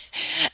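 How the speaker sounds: short, high-pitched laugh. Real, not acted. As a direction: give a brief high laugh. Laughing at the friend's protest.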